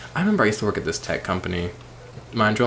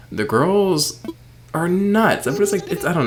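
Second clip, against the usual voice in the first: higher and louder, gushing about it; thrilled, rising squeal; excited gush; 10 semitones higher, 5 dB louder than usual. Real, not acted.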